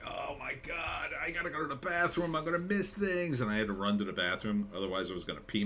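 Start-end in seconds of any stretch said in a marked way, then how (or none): 0.0-3.4 panicked. Retold or imitated. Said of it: "I'm like".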